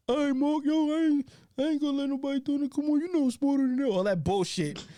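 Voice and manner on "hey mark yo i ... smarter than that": high, fast mimicry of a kid brother promising to behave; imitating Big. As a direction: pitch way up and rattle it off like the little brother. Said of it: "Sound just like Big".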